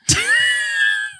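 high squealing burst of laughter; shrieking laugh. Real, not acted. Bursts out laughing about Avatar.

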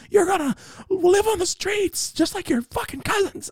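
very high squeaky put-on voice; hammed-up high nagging prophecy; taunting voice of doom. Voice foretelling his ruined future.

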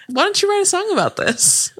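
high, put-on imitation; mock suggestion in a high voice; movie character quote. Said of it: "He's like".

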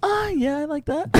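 very high mock girl voice. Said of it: "I'm gonna try girl voice".

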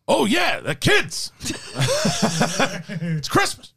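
loud, high, laughing Pacino voice; Al Pacino impression. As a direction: ham up Pacino's voice, breaking into laughter. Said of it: "Mister Pacino, are your kids".

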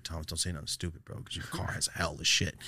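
low-key, hushed talk to himself; inner self-warning. Retold or imitated. Student cautioning himself while being handcuffed, hushed inner voice. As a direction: mutter it softly like a private thought. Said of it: "And I kept thinking".

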